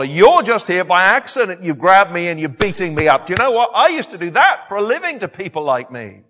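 loud, impassioned self-defence; Paul. Acted. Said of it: "he wants them to know he's one of them".

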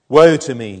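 a loud but measured lament; crying out. A narrator's reading. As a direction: cry it out firmly, without full anguish. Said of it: "he, I cried".

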